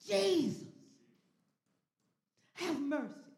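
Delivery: high call, broken by pauses; Bartimaeus crying out. Acted. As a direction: call out, leaving space between phrases. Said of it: "But he cried out".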